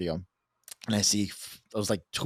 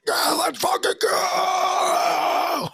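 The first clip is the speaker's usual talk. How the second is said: high, drawn-out ecstatic yell; strained whoop stretched to breaking; celebratory scream; much slower than usual. Retold or imitated. Husband running around the kitchen as guest agrees, high celebratory scream.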